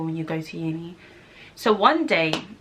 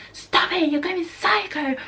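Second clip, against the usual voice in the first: high, pleading, slower mock panic; imitating his panic; 10 semitones higher, slower than usual. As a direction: do the scared boyfriend, high and begging. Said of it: "He was there like".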